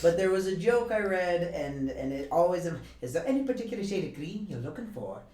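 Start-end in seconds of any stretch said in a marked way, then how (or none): 3.0-5.2 fast, put-on Irish, rattled off; hammed-up bad Irish shopkeeper line; atrocious Irish accent. Co-host doing her go-to atrocious Irish accent. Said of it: "that's one I like to go to".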